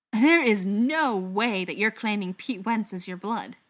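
loud, slow incredulity; emphatic, incredulous protest; disbelief. A narrator's reading. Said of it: "shaking his head in disbelief".

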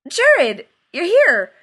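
very loud, high startled cry; jumping in surprise at seeing Jared; startled blurt. Acted. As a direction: blurt it out caught off guard. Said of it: "and blurted".

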